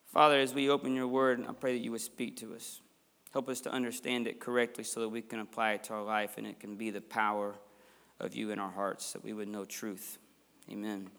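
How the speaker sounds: soft, low prayer voice; hushed opening prayer. Acted. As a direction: pray quietly to God. Pastor leads opening prayer.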